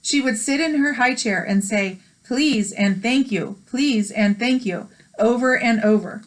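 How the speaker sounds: bright storybook read-aloud; gentle picture-book narration; reading aloud to children. Acted. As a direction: read it like story time for kids.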